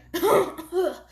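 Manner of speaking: loud sick hacking cough; harsh cough. Acted. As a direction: cough hard like a dying woman. Sick old wife coughing.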